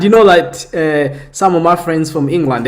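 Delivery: ordinary talk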